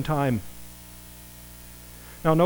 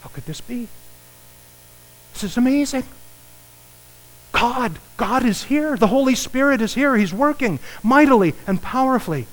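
high, hushed wonder that opens into praise; awestruck crowd; 9 semitones higher than usual. Retold or imitated. Imagined congregation reacting to the miracle, awed and high. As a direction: marvel as if God just arrived. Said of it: "everybody would be going".

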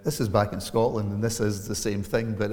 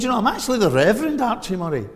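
high, fast and flustered, tripping over the words; flustered stammer; 10 semitones higher, 7 dB louder, faster than usual. Retold or imitated. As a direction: blurt it out like a nervous driver caught out. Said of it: "so I suddenly kind of stuttered".

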